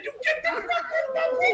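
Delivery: fast, very high squeal through laughter; mock-furious, helpless with laughter; mock anger, cracking up. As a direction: rush it out in a laughing, high-pitched voice. Acts out angry man while laughing.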